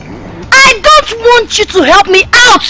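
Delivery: high slow angry shout; furious refusal. Acted. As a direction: spit out each word. Rejects help angrily.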